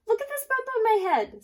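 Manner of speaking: very high, loud and fast hen voice; frantic hen. Acted. Hen showing friends her bump, high and fast. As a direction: rush it out like a frantic little hen.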